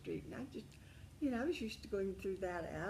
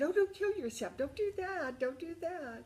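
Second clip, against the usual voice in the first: much higher, louder, alarmed begging; urgent pleading; 10 semitones higher, 8 dB louder than usual. Retold or imitated. Dee Dee begging her friend not to kill herself, high and loud.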